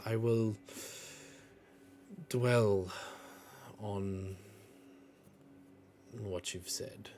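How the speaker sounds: very slow, with long pauses between words; measured character reply. Acted. She nods and considers the request, slow measured character reply. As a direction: weigh every word, softening towards the end. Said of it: "she just kind of nods and she goes".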